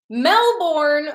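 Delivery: high, loud Melbourne with a strong R, Chicago accent; heavy Chicago accent. Real, not acted. Livestreamer amazed by a viewer's location, heavy Chicago accent. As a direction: call out Melbourne in broad Chicago vowels. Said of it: "I probably said that with a heavy accent".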